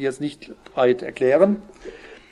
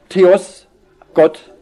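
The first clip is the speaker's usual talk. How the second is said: "Theos" and "Gott" said louder, set apart by pauses; emphasized; 3 semitones higher, 7 dB louder than usual. Shown as a demonstration. Preacher stresses the word placed first in Greek, loud. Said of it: "muss es hier betont vorangestellt heißen".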